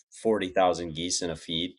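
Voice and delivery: usual speaking voice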